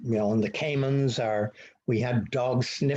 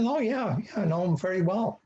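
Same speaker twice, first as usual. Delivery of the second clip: eager reply; 7 semitones higher than usual. Retold or imitated. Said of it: "I said".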